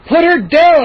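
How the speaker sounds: loud, high shout; breathless shout. Acted. Boy shouting at the gnomes. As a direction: shout at the gnomes while running. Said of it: "I shouted breathlessly".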